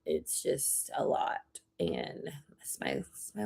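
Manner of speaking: whispering, close to tears; tearful whisper. Real, not acted. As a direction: breathe it out softly, choked up over the cat. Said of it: "And I am so sad right now".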